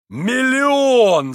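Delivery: high, drawn-out boisterous cry; boisterous mirth. A narrator's reading. Mitya naming an absurd stake, boisterous mirth. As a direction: crow it out as if roaring with mirth. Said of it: "захохотал Митя".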